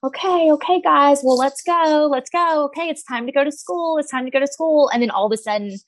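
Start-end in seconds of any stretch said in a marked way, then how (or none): 0.0-2.7 high, sweet coaxing voice; overly nice mom. Retold or imitated. Mom sweetly coaxing kids out the door, high and nice. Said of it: "I try to be so nice". 2.7-4.9 high, sweet coaxing; gently herd the kids; nice mom voice. Retold or imitated. Mom gently telling kids it's school time. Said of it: "I try to be so nice".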